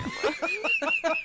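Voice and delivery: high squealing laugh; shrieking laugh. Real, not acted. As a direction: burst into a shrill shocked laugh. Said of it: "really concerned".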